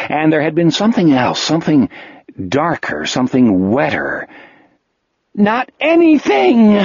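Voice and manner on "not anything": high loud cracking yell; hoarse breaking shout. A narrator's reading. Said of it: "he shouted, his voice breaking hoarsely".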